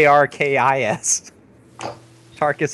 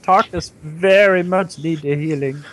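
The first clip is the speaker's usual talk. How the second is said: dim-witted character voice; 8 semitones higher than usual. Pretend. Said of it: "who only speaks in the third person".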